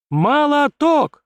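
high, loud, drawn-out cheer; joyful yell. Acted. Kim cheering his new friend's feat, joyful yell. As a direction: yell it out in delight. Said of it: "радостно заорал Ким".

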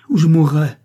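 loud, high impassioned outburst; anguished climax, voice thrown up; desperate cry. A narrator's reading. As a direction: cry it out as a last plea. Climax of his desperate plea.

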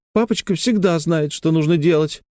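put-on high child's voice boasting of daddy; admiring little girl. A narrator's reading. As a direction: voice a trusting small girl. Narrator voices girl praising daddy.

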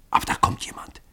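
hushed, near-whispered alert; breath-only lookout's warning. A narrator's reading. Hidden watchers spot someone approaching.

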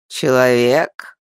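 put-on slow, low creaking old voice; creaky old elf. A narrator's reading. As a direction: creak it out like an ancient tree. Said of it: "проскрипел один из стариков".

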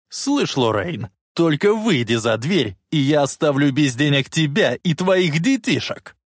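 threatening tone. A narrator's reading. Biff warning Lorraine not to leave, threatening tone. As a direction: menace her with each condition. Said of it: "Биф перешёл на угрожающий тон".

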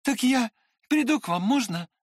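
high, eager little request; Trishatov's hurried babble. A narrator's reading. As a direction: babble a quick plea while leaving. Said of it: "пролепетал мне наскоро Тришатов".

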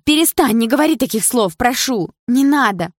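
high, loud desperate begging; frantic high-pitched plea; panicked pleading. A narrator's reading. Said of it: "выкрикнула в панике Карина".

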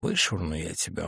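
low, quiet grim threat; grim quiet menace. A narrator's reading. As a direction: say it darkly and low. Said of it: "мрачно произнёс Нунан".

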